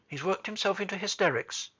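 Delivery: a touch of hurried alarm; great hurry. A narrator's reading. Nurse reporting the boy's hysterics, in a hurry. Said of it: "said in a great hurry".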